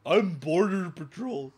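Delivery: slow, loud, pompous announcement; put-on self-important voice; mock border agent. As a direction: puff yourself up as a silly officer. Mocking the officer's self-importance.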